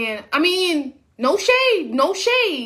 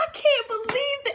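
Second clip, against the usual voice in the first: much higher exasperated voice; upset; 9 semitones higher than usual. Real, not acted. Telling viewers about the fare, high and upset. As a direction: vent the outrage pitched high. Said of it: "I was so upset".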